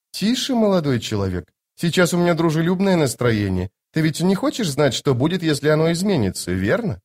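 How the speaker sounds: veil a threat in sweetness; sweetly menacing sorceress. Acted. Voices Lady Envy in dialogue.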